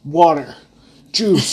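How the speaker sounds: high, loud, insistent offering; mock-bossy hydration coach; nagging partner. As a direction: push the drinks on her. Partner nagging her to drink.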